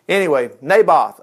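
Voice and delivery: ordinary delivery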